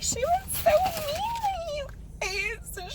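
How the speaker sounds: very high, drawn-out hammed-up crying; mock wailing. Acting out a complaint to you guys, mock wail. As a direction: wail it out slowly like a mock sob.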